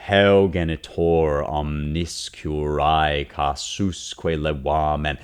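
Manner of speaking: very slow, heavy Latin, every syllable held; slow spondaic recitation. Shown as a demonstration. Teacher scans the Latin line aloud, slow and heavy. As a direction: draw the line out grave and heavy. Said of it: "serious or grave or heavy".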